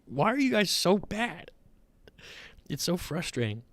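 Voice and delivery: high plaintive complaint, then dropping soft; frustrated fan's lament; exasperated. Real, not acted. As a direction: plead with a team that keeps letting you down. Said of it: "It just make me mad".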